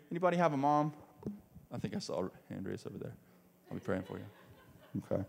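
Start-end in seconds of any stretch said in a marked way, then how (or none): none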